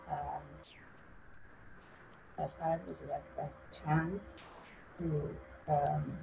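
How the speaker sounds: very soft, trailing away; hushed, hesitant start that fades; too quiet to hear. Real, not acted. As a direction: speak barely audibly, with halting pauses. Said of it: "can you speak up?".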